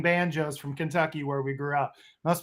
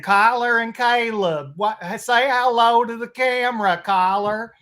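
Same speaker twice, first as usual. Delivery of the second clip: grandma Judy impression; 6 semitones higher, 4 dB louder than usual. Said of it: "I have a great impression of my grandma Judy".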